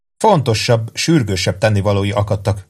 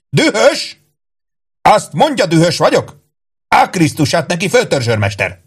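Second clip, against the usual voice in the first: high, incredulous indignation; outraged shouting; 7 semitones higher than usual. A narrator's reading. Old captain turning on his sergeant, shouting in outrage. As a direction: explode at the accusation, pitch jumping. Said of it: "Kiáltotta az öreg".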